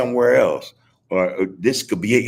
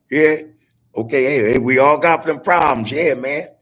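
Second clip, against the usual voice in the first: louder, higher burst of excitement; excited; 4 semitones higher, 7 dB louder than usual. Real, not acted. To his listeners, an excited louder reply. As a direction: pump it up loudly.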